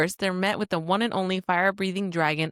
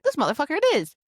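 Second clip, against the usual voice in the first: disbelief turning into a yelp; shocked recognition; 8 semitones higher, faster than usual. Retold or imitated. Fan recognizing the returning character, high shocked yelp.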